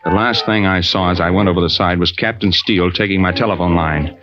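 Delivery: low, steady storyteller voice; story narration. A narrator's reading. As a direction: tell it looking back, calm and even.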